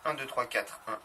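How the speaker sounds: ordinary talk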